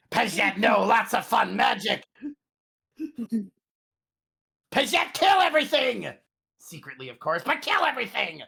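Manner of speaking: high shouting caveman speech; brutish character voice. Acted. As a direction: bellow it like a simple brute. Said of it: "player voicing own character".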